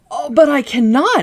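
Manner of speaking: Dorothy startled when Oz asks her to kill a witch; surprised exclamation. Acted. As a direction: blurt it out, shocked by the request. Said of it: "exclaimed Dorothy, greatly surprised".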